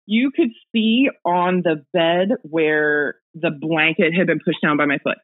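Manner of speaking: usual speaking voice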